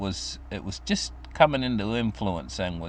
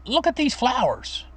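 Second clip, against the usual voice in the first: put-on little boy's voice; 13 semitones higher than usual. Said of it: "I'd say, like".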